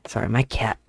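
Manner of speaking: ordinary delivery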